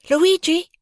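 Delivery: high loud call across a crowd. A narrator's reading. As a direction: shout his name over the crowd. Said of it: "the children cried".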